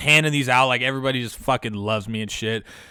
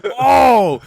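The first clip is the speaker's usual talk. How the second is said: much higher and louder startled yelp; 10 semitones higher, 9 dB louder than usual. Retold or imitated. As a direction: caught off guard, blurt it out. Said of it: "I'm like".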